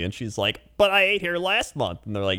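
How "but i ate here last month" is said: high customer voice. Retold or imitated. Imitating customer.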